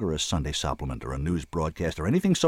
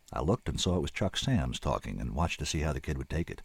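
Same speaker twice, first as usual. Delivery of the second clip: ordinary delivery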